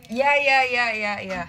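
loud embarrassed iya repeated; flustered loud yes yes; flustered yelling. Real, not acted. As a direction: shout it out in a fluster. Embarrassed streamer begging chat stop.

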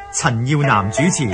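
plain reading voice

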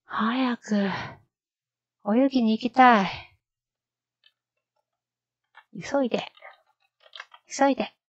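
high, loud eager pleading; impatient eager plea. Shown as a demonstration. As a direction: beg to go right now. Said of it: "learner acts out the impatient line".

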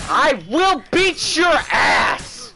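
loud, high, drawn-out threat; shouted threat. Real, not acted. Gamer threatens the enemy who beat him, shouted threat. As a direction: yell it slowly at your opponent.

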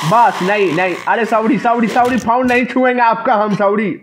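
high, hurried pleading sorries; frantic apology. Real, not acted. Player caught by the nun at her phone, high frantic sorries. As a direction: plead with her not to hurt you over the phone.

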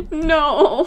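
short loud cry; sharp no; loud protesting no. Real, not acted. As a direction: shout it in dismay.

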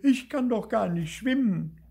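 loud, high wailing complaint; hammed-up tearful little ghost; sobbing. A narrator's reading. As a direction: bawl with wounded outrage. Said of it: "begann noch lauter zu schluchzen".

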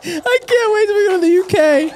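put-on high, excited shout in a British accent. Joking about British accents.

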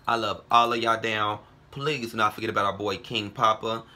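ordinary talk, nothing marked

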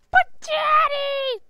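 put-on high, slow whining kid; whiny child. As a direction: wail it like a bratty kid.

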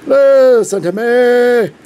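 high, slow, loud "Listen"; loud dramatic plea. Pretend. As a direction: boom the warning. Repeated line, louder take.

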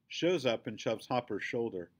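ordinary delivery, nothing marked

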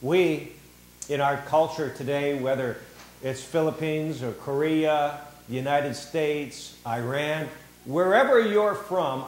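ordinary talk

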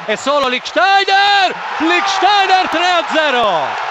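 slow roaring goal call; goal shout. Real, not acted. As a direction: bellow it as the ball goes in.

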